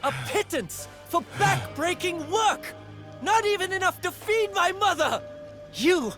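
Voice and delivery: angry cry of injustice, pitch soaring; furious outburst. Acted. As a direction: hurl the accusation with bitter force. Confronting the oppressor in a quarrel.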